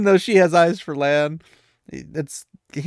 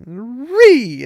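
door squeak; 10 semitones higher, 10 dB louder than usual. Retold or imitated. Door banging shut.

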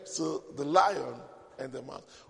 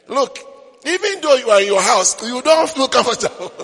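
emphatic; 7 semitones higher, 12 dB louder than usual. Real, not acted. Laughing emphasis to congregation.

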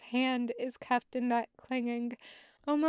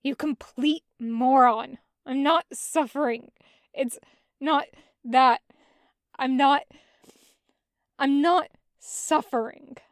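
loud, exasperated insistence; loud protest; 9 dB louder than usual. Acted. Zhang Cheng loudly insists to his brother he is fine. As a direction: shout him down, denying it.

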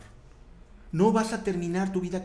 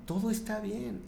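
imitated reassuring daughter; 7 semitones higher than usual. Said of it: "me dice, "Papá".

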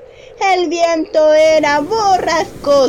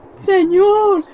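higher, crying, eager request; higher teary excitement; overjoyed, tearful plea; 5 semitones higher than usual. Acted. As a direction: beg to walk to him, beside himself with joy. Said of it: "casi fuera de sí de tanta alegría".

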